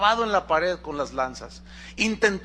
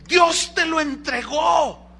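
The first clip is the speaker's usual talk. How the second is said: urgent shout; 7 semitones higher, slower than usual. Retold or imitated. Men urging David to kill Saul.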